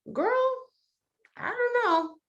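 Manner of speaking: very high, wary little voice; doubting inner voice. Acted. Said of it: "she had these two voices, one saying".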